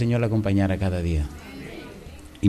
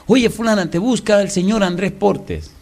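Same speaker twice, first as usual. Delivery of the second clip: calling out; 6 semitones higher than usual. Retold or imitated. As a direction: announce the visitor across the house. Husband calls wife again.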